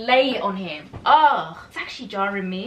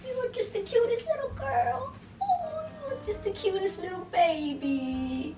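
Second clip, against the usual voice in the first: much higher cooing pet talk; 8 semitones higher, 6 dB quieter than usual. Real, not acted. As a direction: coo at the puppy. Baby talk to dog.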